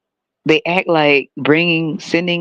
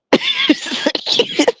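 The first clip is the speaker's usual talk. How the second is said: high squeaky laugh. Real, not acted. Laughing at own politician bit.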